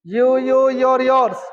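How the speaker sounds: forceful chanted classroom drill; loud recitation. Shown as a demonstration. Teacher urging a pupil to speak up, loud recitation. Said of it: "बोल जोर से".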